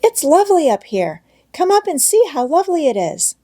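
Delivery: put-on delighted child calling down. A narrator's reading. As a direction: call down to grandfather, thrilled. Heidi calls down from the loft.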